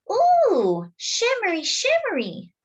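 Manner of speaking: put-on high, cooing toddler voice; little brother marveling, pitched very high; delighted little boy. Toddler brother admires sister's skirt, high and cooing.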